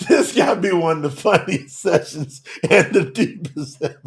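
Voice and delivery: real laughter breaking up the sentence; cracking up. Real, not acted. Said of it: "Y'all cracking me up".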